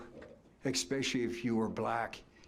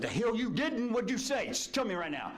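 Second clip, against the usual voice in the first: angry demand; 12 semitones higher than usual. Retold or imitated. Young coach confronting the runner.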